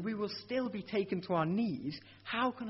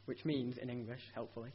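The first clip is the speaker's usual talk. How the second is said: usual speaking voice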